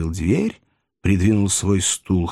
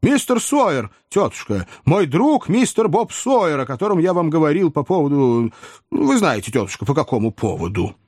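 gushing, higher-pitched nephew; effusive welcome; 6 semitones higher than usual. A narrator's reading. Tipsy nephew introducing his friend to aunt, effusive. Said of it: "с изъявлениями радости и любви".

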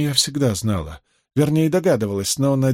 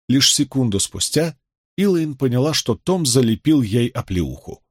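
ordinary delivery

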